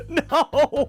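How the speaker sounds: high-pitched disbelief through laughter; helpless laughing. Real, not acted. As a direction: crack up while saying it. Cracking up at absurd post.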